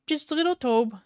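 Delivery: high squeaky cutesy voice; cute baby voice. Pretend. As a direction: talk to a tiny toy like a baby. Cute voice for toy engine.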